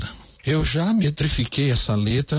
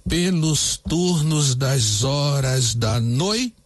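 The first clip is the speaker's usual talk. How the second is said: very slow, every syllable spaced out; measured scansion read; syllable counting; much slower than usual. Shown as a demonstration. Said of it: "vamos contar".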